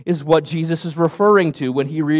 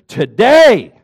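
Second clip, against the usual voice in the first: proclaimed loud; 2 semitones higher, 6 dB louder than usual. Acted. Preacher voicing Jesus' proclamation.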